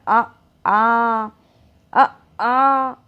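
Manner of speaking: आ held open from the throat; throat vowel demo. Shown as a demonstration. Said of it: "कंठ में पड़ रहा है ना".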